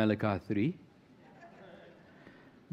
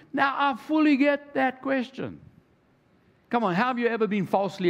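much higher, shouted burst of agreement; excited shout; 9 semitones higher than usual. Real, not acted. Preacher shouts his sympathy for the accused to the congregation. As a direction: erupt into it as if the question hits home.

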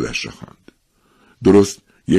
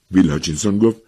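plain reading voice

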